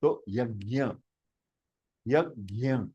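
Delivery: यज्ञ said softly as yəgja; demo yajna. Shown as a demonstration. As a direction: model यज्ञ gently.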